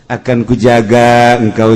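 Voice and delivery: ordinary delivery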